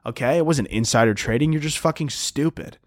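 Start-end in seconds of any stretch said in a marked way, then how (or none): none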